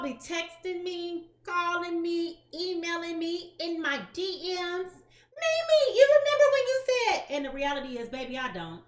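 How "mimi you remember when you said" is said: high, loud imitation of an eager viewer; mock fan voice. Quoting a viewer's message in a high eager voice. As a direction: play an excited follower calling her name.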